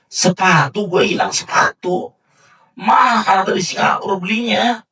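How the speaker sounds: high, loud complaint over lost expensive shoes; indignant complaint. Retold or imitated. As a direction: whine it like a spoiled rich man. Rich man complains about lost shoes.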